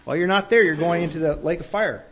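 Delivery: usual speaking voice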